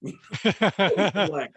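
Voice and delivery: laughing through the word; real laughter. Real, not acted. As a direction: crack up at your own cheeky line. Cracks up at own line.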